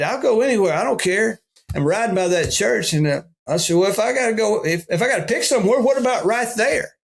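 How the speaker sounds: high, eager pleading to the Lord; eager offer. Retold or imitated. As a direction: throw it out, ready for anything. Eager reply to God.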